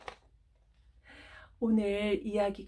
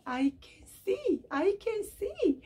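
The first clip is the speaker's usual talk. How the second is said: slow, wondering joy, rising high; joyful cry; 10 semitones higher, much slower than usual. Acted. Blind man marveling he can see, joyful. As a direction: let the joy break through.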